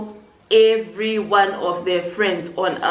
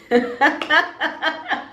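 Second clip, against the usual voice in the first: burst of laughter; 8 semitones higher than usual. Real, not acted. Laughing at the name Cookie.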